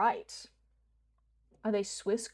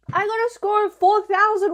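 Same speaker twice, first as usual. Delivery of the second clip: excited; 10 semitones higher, 10 dB louder than usual. Real, not acted. Announcing game score.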